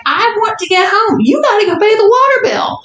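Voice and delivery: high-pitched mock whining mom; insistent mom. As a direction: imitate mom pressing to leave. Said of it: "And she's like".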